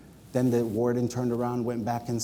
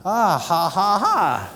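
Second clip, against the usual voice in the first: imitated laugh; 5 semitones higher, 5 dB louder, much slower than usual. Mentor laughs on seeing him.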